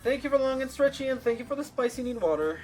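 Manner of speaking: high, loud and bright thanks; excited thanks. Real, not acted. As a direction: thank chat with big enthusiasm.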